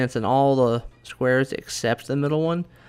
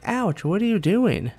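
high, indignant yelp; high offended sprite; 8 semitones higher than usual. Retold or imitated. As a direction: squeal like a hurt little sprite. Sprite hit with hammer.

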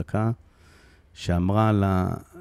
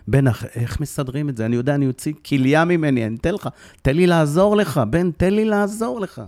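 father begging his son to let him help, voice climbing; desperate pleading; 6 semitones higher than usual. Retold or imitated. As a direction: beg your son to let you help. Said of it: "אני מתחנן אליו".

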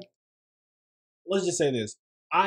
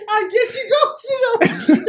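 high-pitched, louder, through laughter; laughing retelling; 6 dB louder than usual. Retold or imitated. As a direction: barely get the quote out for laughing. Retelling while cracking up.

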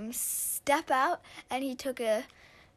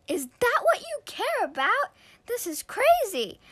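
higher and louder, exasperated; exasperated shout; 11 semitones higher, 10 dB louder than usual. Acted. Sister bursting in on her brother's excuse, exasperated shout. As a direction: throw up your hands, loud.

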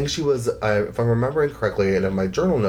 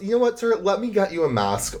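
much higher, mock-sweet brush-off; fed-up flight attendant; 10 semitones higher than usual. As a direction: imitate her curt sugary wrap-up. Flight attendant ends the argument.

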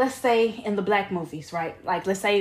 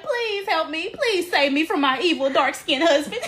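high-pitched mock plea for rescue; put-on helpless wife, pitched high; pleading damsel in distress; 6 semitones higher than usual. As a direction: beg like a hammed-up movie heroine. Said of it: "And she's like".

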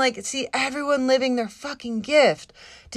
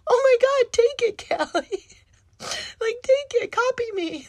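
much higher, eager and generous; gushing friend; 11 semitones higher than usual. Retold or imitated. Friend urging her to take the idea, high and gushing. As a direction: wave the idea over to her, bubbling. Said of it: "she's like".

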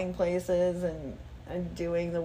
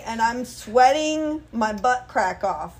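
normal talking voice

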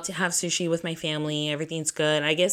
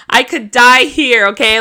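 dramatic panic; 5 semitones higher, 11 dB louder than usual. Retold or imitated. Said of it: "I'm dramatic like that".

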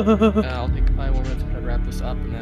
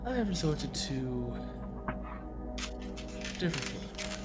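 sinister villain; 6 dB quieter than usual. Acted. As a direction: let the menace creep in softly. Villain cryptic monologue, whispering parts.

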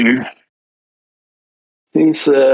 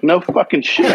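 sarcastic; 6 semitones higher than usual. Retold or imitated. Sarcastic retort to agent.

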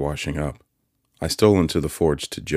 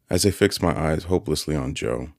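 plain reading voice

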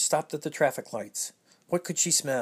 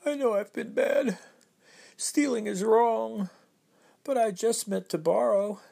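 higher, contrite pleading voice; the thief's raised, sorry little voice, rushing at the end; sniffling thief; 8 semitones higher than usual. Acted. Book thief explaining he meant to borrow, higher contrite voice. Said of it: "he sniffled".

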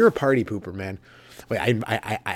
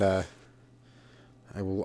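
ordinary talk, nothing marked

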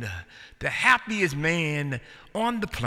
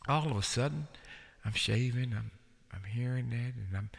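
usual speaking voice

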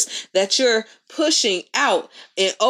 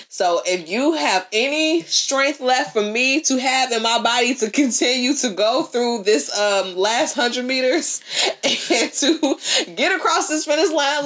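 mock dramatic prayer; 3 semitones higher than usual. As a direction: pray it out like a runner on the last leg. Acts out a runner's prayer mid-race.

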